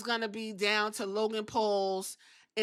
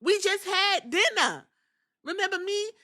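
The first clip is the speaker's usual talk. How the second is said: high pleading voice; 8 semitones higher than usual. Retold or imitated. Imitating Jackie Redmond pleading with Jay backstage, high and hurt. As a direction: plead like a jilted dinner date. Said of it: "She said".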